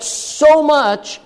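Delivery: "so much" said high and stretched; superlative so much. Shown as a demonstration. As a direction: lean hard on "so much". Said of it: "Is it ever used like that".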